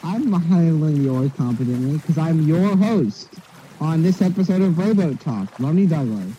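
indignant host. Acted. Host answering the complaint about his oars, loud and indignant.

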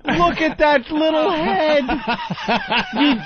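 high, slow cutesy coo over a little head. Real, not acted. Host gushing at a cute chimp on TV, high and slow. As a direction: melt over the monkey, stretching the words out high.

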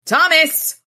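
high, loud call of the name; urgent call. Acted. Harry warning Thomas about the ghoul, high and loud.

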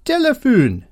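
high-pitched comic Dutch accent; mock Dutch accent. Shown as a demonstration. Showing how funny phone menus get, mock Dutch accent. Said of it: "set your phone's language to Dutch".

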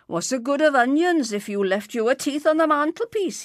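loud, high scornful question; scoffing retort. A narrator's reading. Uncle mocking Mister Weasley's onions, scornful. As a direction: jeer loudly at a useless prize.

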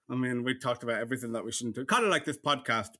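ordinary talk, nothing marked

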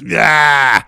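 loud, high roar of "yeah"; imitated goal-celebration yell; triumphant roar. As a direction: roar it in the defender's face. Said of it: "turns around to the other defender and goes".

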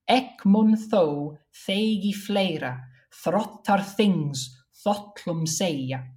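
put-on slow, deliberate Old Norse recitation; Old Norse verse recital. Shown as a demonstration. Scholar demonstrating a stanza of Old Norse, slow recital. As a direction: recite the verse line by line, unhurried.

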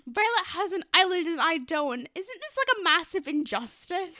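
loud, high spoiled complaint; whiny demand. Retold or imitated. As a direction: complain like a spoiled rich kid. McKenna whining to her parents.

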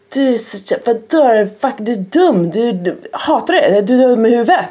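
hammed-up angry child, stumbling over words; imitated child's insults. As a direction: spit out halting insults like an angry kid. Imitating her son's angry insults.

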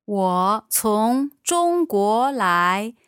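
normal talking voice

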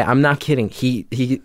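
ordinary talk, nothing marked

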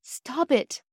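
high-pitched, distressed plea; a queasy child calling a halt; upset cry. A narrator's reading. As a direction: cry out in mild alarm.

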